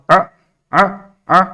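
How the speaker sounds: short separate "آ، آ، آ" for the letter ain; lisping baby-talk sound. Shown as a demonstration. Teacher coaching a child on ain, lisped "آ". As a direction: say "آ" childlike, in three detached bursts. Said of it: "عین کا بیٹا توتی زبان میں بولو".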